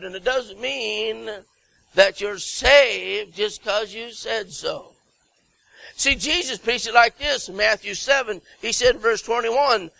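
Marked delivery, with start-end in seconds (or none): none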